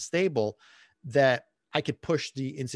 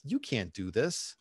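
soft, slow, hushed self-doubt; inner doubt voice; 2 semitones lower, 7 dB quieter, slower than usual. Retold or imitated. Podcaster voicing his inner critic, slow and hushed. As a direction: let the doubt creep in slowly and quietly. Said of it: "the first thought in my mind was".

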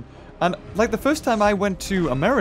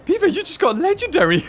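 high, put-on voice of an incredulous viewer; hammed-up high-pitched protest; mock viewer complaint; 12 semitones higher, 4 dB louder than usual. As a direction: squeal it like a viewer in disbelief. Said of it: "watching this would think".

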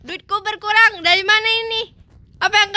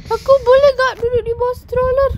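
put-on whiny baby; 6 semitones higher than usual. Baby character whining in game.

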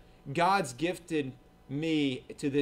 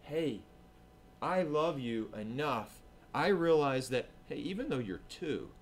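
loving correction; 2 semitones lower, 5 dB quieter than usual. Retold or imitated. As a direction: speak gently to the child. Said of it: "The correct response is come to them and say".

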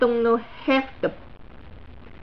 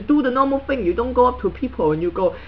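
ordinary delivery

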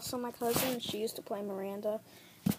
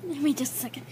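straining, breathless count; doing push ups; 6 semitones higher, 6 dB louder, slower than usual. Real, not acted. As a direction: count out loud through the strain. Push-ups counted during one minute.

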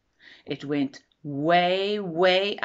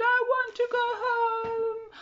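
plaintive. Acted. Lost bird wants to go home.

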